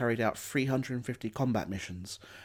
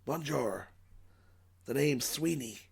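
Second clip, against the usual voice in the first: put-on French greeting in an American accent; an American pilot saying bonjour, lightly voiced; a hint of an American accent on French; Charles Sweeney's American accent. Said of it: "with a strong American accent".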